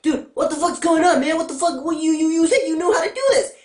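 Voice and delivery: high, exasperated disbelief; retold outrage. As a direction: whine it like you got betrayed. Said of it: "And I was like".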